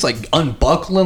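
usual speaking voice

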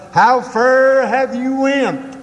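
high, drawn-out mock holler; imitated preacher's yell. Country preacher hollering at his radio listeners, drawn-out mock yell. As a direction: mimic a country preacher yelling, stretching "fur". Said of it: "fairly blast the elements by yelling".

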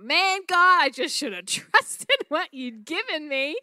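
high, loud exclamation to God, breaking into a laugh; acted-out "should have known" outburst; rueful realisation.

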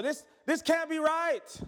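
high, drawn-out protest of disbelief; mock disbelief. Preacher playing a baffled reader of the verse, mock disbelief.

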